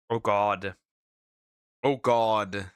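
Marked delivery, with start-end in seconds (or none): none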